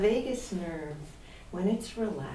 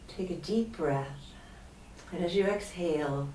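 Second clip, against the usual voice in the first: usual speaking voice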